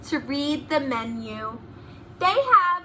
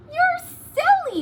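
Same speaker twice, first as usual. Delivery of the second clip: high, louder playful "You're silly"; excited exclamation; 5 dB louder than usual. Acted. Pupils to silly teacher, playfully exclaiming. Children's refrain in read story.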